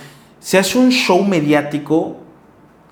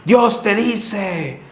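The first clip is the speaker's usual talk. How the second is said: slow, drawn-out mock prophecy, pitched higher; mock prophet; 5 semitones higher, much slower than usual. Preacher mocking false prophets, slow hammed-up prophetic voice. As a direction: mimic a showy prophet, stretching each word.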